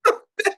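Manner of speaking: short, high burst of laughter. Real, not acted. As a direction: let out a quick real laugh. Amused after the co-host's Amen.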